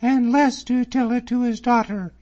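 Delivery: high, loud, proud reproach; indignant cry. A narrator's reading. Miss Drummond rebuking David over her father, high indignant cry. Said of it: "she cried out".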